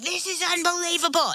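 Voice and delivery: put-on high-pitched childlike amazement; pumped-up kids. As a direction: imitate kids thrilled out of their minds. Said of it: "they are pumped out of their minds".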